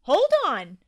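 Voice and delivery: high, loud shout. A narrator's reading. Toby interrupting the new tooth, shouting. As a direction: yell to stop everything. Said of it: "shouted Toby".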